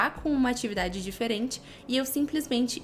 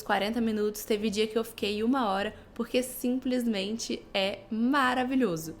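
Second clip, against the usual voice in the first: usual speaking voice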